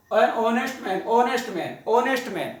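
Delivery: "honest" with a silent h, said "onest"; teacher models "honest man" starting on the o sound; honest man said right. Shown as a demonstration. Teacher modelling "honest" with silent h for students. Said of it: "ऑनेस्ट man के हॉनेस्ट man नहीं होगा".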